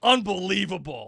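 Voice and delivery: loud, high exasperated exclamation; hammed-up indignation; mock outrage. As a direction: cap a playful rant with outraged disbelief. End of mock rant at Melinda.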